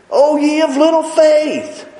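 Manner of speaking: high, loud rebuke; stern loud rebuke of the disciples; scolding. Retold or imitated. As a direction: scold them, emphasis on little. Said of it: "I think he scolded them".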